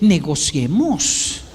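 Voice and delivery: slow, mock-diplomatic proposal; hammed-up negotiator. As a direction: stretch it slowly like opening a negotiation. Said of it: "lo que está diciendo internamente es".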